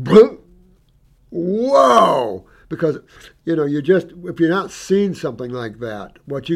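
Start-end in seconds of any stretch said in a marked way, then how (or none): 0.0-0.4 loud, high popping bloop sound; jaw-drop bloop. Retold or imitated. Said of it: "my jaw went". 1.3-2.5 loud high astonished exclamation; amazed whoa. Real, not acted. Amazed at the healed dog.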